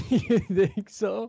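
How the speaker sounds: slow, laughing tease. Real, not acted. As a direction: laugh through the question. Teasing laugh.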